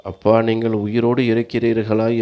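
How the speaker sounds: ordinary delivery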